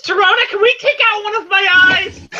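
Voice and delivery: high, eager pleading; Lena. Acted. Lena asks Serona for a favor, high and eager. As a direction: beg in a high, excited voice.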